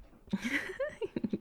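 sudden squealing giggle; ticklish giggle. Acted. Teasing a lover about a ticklish belly button, giggling. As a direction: burst into a high little giggle. Reacts to partner being ticklish.

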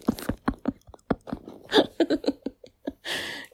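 long high giggle; giggling fit. Real, not acted. Laughing after baby goats.

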